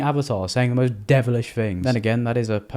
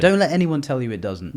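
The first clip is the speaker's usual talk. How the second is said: ordinary delivery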